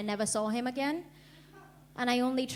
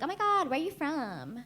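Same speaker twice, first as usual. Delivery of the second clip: bubbly mock American accent, a touch higher; lightly voiced valley-girl American; 4 semitones higher than usual. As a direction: play a gushing American girl. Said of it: "she's like".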